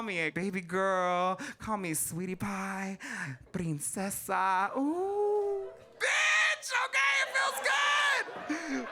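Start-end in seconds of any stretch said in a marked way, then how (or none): 4.8-8.9 delighted squeal. Acted. Queen squealing with delight at pet names. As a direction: squeal it like a swooning princess.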